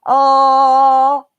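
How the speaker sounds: loud open "Oh." at the doctor; throat-open oh. Shown as a demonstration. Pretending to be at the doctor, loud open oh. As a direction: open wide and say "Oh.". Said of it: "and go".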